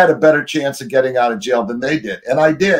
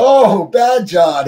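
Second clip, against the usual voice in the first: much higher, louder and drawn out very slowly; mock alarm; 8 semitones higher, 5 dB louder, much slower than usual. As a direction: stretch "Oh, Bad John" up high in hammed-up alarm. Narrator reacts to Bad John.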